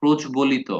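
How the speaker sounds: প্রজ্বলিত with the ব-ফলা sounded as b, 'projbolito'; spelling-pronunciation. Shown as a demonstration. Teacher reads প্রজ্বলিত as spelt, not as said. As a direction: pronounce the b in প্রজ্বলিত clearly. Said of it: "কিন্তু আপনি কি পড়বেন?".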